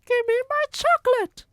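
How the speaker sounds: put-on very high, loud boy demanding; cartoonish high boy demanding; Bruno. A narrator's reading. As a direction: whine for the chocolate in a high kid voice. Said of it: "Bruno demands chocolate".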